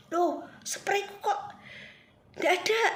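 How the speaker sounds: mother's high, puzzled cry of surprise; high-pitched mock alarm; surprised mother. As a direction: play the mother discovering her spray is gone. Mother finds her spray missing.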